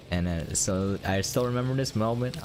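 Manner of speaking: ordinary talk, nothing marked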